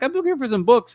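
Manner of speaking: high-pitched, mock-timid reply; shy. Nervous newcomer answering a stranger at Comic Con, shy. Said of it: "you look a little shy".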